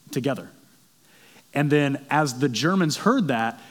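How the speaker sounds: ordinary delivery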